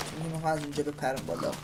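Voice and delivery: ordinary talk, nothing marked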